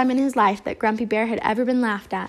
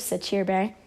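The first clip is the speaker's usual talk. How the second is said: ordinary delivery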